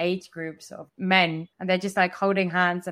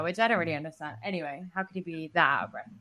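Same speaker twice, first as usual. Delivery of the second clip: ordinary delivery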